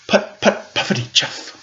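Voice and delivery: soft chugging tractor putt-putt, unvoiced; happy tractor putter. Shown as a demonstration. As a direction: puff it out like a cheerful little engine. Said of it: "That last bit was the sound of a tractor being happy".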